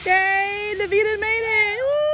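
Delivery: high, bright cheering; excited cheer. Real, not acted. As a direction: cheer her finish. Cheering kid finishing.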